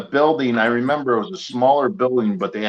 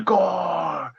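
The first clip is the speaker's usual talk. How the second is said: high, drawn-out mock yell; retold yell; 9 semitones higher than usual. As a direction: imitate a fan yelling, stretched and high. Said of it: "His buddy just starts yelling".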